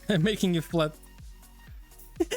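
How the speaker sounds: ordinary delivery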